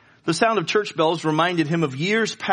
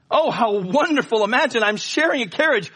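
higher, louder, delighted gush; thrilled to meet the author; excited exclaim; 10 semitones higher, 4 dB louder than usual. Acted. Young lady thrilled to meet poet in carriage, excited exclaim. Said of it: "she exclaimed".

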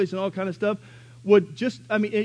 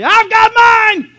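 slow, much higher, louder gloating shout; triumphant shout; 16 dB louder, much slower than usual. Retold or imitated. Saved believer gloating at the world, loud shout. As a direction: crow it over everybody. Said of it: "and say".

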